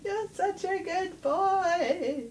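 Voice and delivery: put-on very high, slow, cooing baby talk; cat voice. Owner coos at her cat in a very high, slow voice. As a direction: coo it up high and slow like doting on a pet. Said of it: "in my cat voice".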